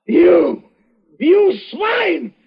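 high, strained, half-shouted terror; choking out each word in panic; rasping strained panic. Acted. Poisoned man panics at his killer.

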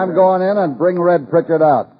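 high, projected, firm announcement. Acted. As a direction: call it out over the crowd.

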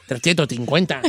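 very low, small mumble; name the amount meekly; sheepish friend. Acted. Friend finally admits the price to host, sheepish.

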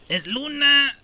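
usual speaking voice

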